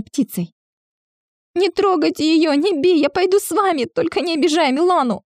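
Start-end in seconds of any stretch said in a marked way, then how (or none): 1.5-5.2 put-on high, strained child's cry; desperate shout. A narrator's reading. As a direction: scream it out in desperation. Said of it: "надсадно выкрикнул Васятка".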